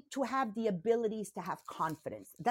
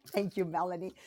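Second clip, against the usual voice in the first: ordinary delivery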